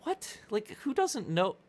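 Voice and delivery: high, disbelieving squeak; incredulous. Retold or imitated. Retelling his reply to mom, high incredulous squeak. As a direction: act baffled that anyone wouldn't know her. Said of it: "And I'm like".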